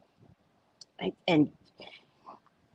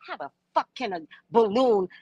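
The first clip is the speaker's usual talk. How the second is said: usual speaking voice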